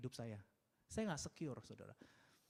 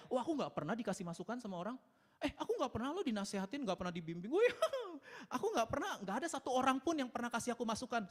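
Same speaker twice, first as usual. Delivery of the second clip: mock bragging; 6 semitones higher, faster than usual. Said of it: "saudara jangan bangga ya".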